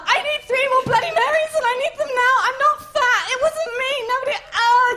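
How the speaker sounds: high, loud, hysterical rant; hammed-up shrill meltdown; frantic Menstruation character. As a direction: rant defensively in mock hysterics. Acts frantic menstruation at bar.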